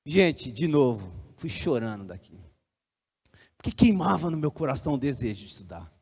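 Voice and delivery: low, soft, downcast confiding; dejected. Real, not acted. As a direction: let the voice sink with disappointment. Conta que saiu chorando. (desanimado, em voz baixa)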